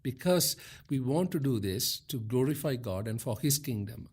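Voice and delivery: ordinary delivery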